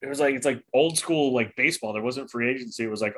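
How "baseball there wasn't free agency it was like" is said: ordinary talk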